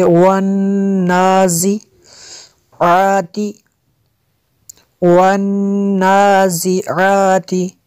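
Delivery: slow chanted "वन्नाज़िआति", rounded w, held nasal n; rounded-lip waw. Shown as a demonstration. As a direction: round the lips on the opening w of "वन्नाज़िआति". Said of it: "वाव पे ओंठों को गोल करेंगे".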